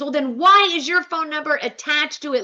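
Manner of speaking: high-pitched mild mock yell; retold heated challenge; mock scream. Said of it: "Heather screams".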